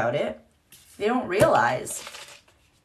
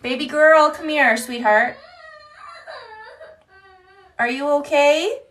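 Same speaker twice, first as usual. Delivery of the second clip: louder, higher soothing call; 7 semitones higher, 5 dB louder than usual. Real, not acted. Mom calls her crying daughter over, soothing and higher.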